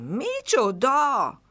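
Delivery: slow, high amazed gasp of a question; a little bear cub wide-eyed in wonder; child cub amazed at the news. A narrator's reading. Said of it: "ámult pocak".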